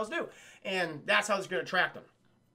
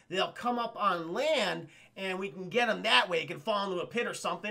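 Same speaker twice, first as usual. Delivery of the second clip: ordinary talk, nothing marked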